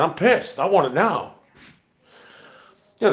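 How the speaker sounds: normal talking voice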